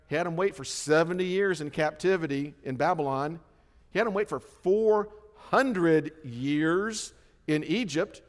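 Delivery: ordinary talk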